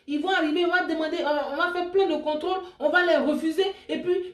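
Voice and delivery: high, fast and urgent, piling up the plan; high, worked-up retelling of her own panic; anxious pleading. Retold or imitated. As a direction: rush through it like a frantic bride.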